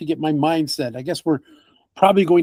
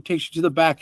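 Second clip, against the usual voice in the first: ordinary talk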